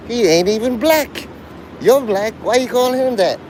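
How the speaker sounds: high-pitched mock indignation; imitated anger. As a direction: act out an angry bystander objecting. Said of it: "They're gonna get angry".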